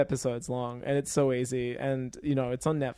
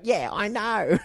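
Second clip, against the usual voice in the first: higher-pitched imitation of a little old British lady; rough British accent; 5 semitones higher, slower than usual. Old lady admitting it to the lead, rough British accent. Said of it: "really rough British accent as this, this tiny little old lady".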